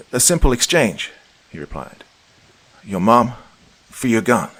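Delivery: smug villain. Acted. Villain bargaining smugly.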